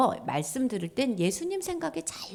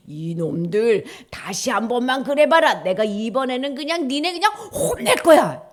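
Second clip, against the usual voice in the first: stern scolding; 3 semitones higher, 7 dB louder than usual. Acted. Imagined God scolding.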